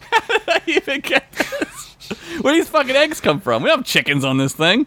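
high, laughing incredulous rant; laughing mock outrage. Cohost riffing on Archer's eggs, laughing mock outrage. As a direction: crack up while ranting.

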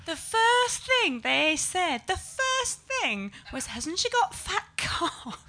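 high, slow mock voice of the date; comic telling a crowd what her date said, high and slow; quoting the date. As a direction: drawl it out high like the boyfriend repeating his bandmates. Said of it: "And he goes".

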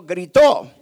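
loud burst with a falling end; emphatic shout. Shown as a demonstration. Preacher has the congregation repeat the word, emphatic shout. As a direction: belt out the single word. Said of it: "No dijo, y dijo, gritó".

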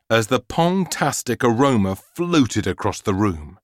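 plain reading voice

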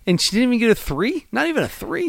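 high-pitched, disbelieving questions; real incredulity, pitched well up; incredulous. Real, not acted. Incredulous at co-host's low score.

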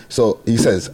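ordinary delivery

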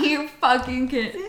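normal talking voice

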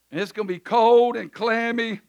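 ordinary talk

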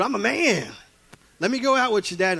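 put-on boy begging dad. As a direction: beg your dad like a nine-year-old who wants to work. Preacher voices his boyhood self begging Dad.

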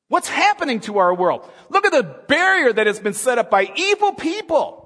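loud, high fervent preaching; preaching at full force. Acted. Preacher stirring the congregation, loud and high. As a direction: rouse the church against the barrier.

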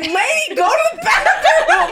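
high, loud exasperated shout; parents yelling. Retold or imitated. Parents yelling at vomiting Lainey, exasperated shout. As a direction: yell at her to get to the bathroom.

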